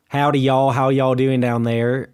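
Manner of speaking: drawled Southern howdy, slowed down; Southern accent. Shown as a demonstration. Asked to imitate the South, Southern drawl. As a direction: lay on a friendly Southern drawl. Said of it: "can you imitate it?".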